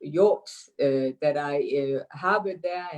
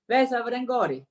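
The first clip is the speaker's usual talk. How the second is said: lively, higher-pitched Danish greeting; Danish accent; 6 semitones higher than usual. Shown as a demonstration. Guest shows host her most Danish phrase, in a Danish accent. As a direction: say it in very Danish fashion. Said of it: "say the most Danish thing you can say in a Danish accent".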